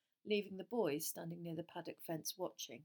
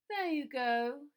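smug drawl; 7 semitones higher, 7 dB louder, slower than usual. A narrator's reading. Malfoy smug.

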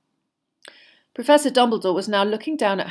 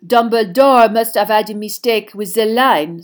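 put-on loud French-accented suggestion; haughty shrug in the voice; French headmistress, dismissive; 3 semitones higher, 10 dB louder than usual. Headmistress blaming Dumbledore's age line, dismissively. Maxime speaks in character.

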